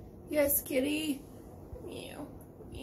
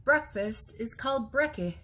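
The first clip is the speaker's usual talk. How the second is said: usual speaking voice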